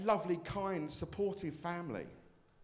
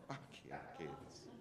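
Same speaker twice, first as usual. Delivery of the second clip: a low, much softer throwaway curse; muttered aside; 14 semitones lower, 11 dB quieter than usual. Acted. Comedian muttering to imagined kids, soft low aside. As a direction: toss it off under your breath.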